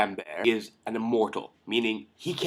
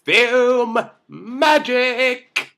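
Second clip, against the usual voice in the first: a much higher, very slow, hammed-up exclamation; sarcastic film magic; 12 semitones higher, 7 dB louder, much slower than usual. Reviewer mocking the movie's beheading logic, drawn-out sarcasm. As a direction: stretch it out in mock wonder.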